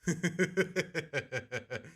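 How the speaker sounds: long run of chuckles; real laugh. Real, not acted. Streamer amused by the Patrick line, laughing. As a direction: keep chuckling at the silly clip.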